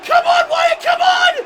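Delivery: very high, very loud commentator scream; real full-throated shriek of excitement; ecstatic screaming. Real, not acted. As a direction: scream your favourite wrestler on. Commentator erupts at a big move.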